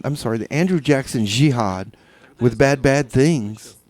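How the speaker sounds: ordinary delivery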